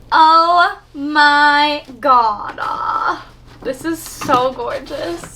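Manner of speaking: very slow, drawn-out awe; awed. Real, not acted. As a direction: let the wonder stretch out slowly. Awed first look at box.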